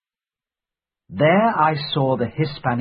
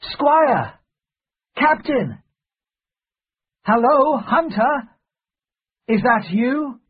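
high-pitched calling out; shouting; 13 semitones higher, 4 dB louder, slower than usual. A narrator's reading. Narrator hailing friends at the stockade, shouting. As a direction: call out to friends across a distance. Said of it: "I shouted".

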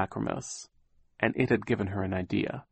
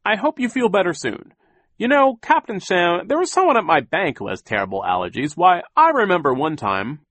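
much higher, louder fussy banker; chatty Mr Poe; 9 semitones higher, 8 dB louder than usual. A narrator's reading. Poe chattering to Captain Sham, high and fussy. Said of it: "Mister Poe said".

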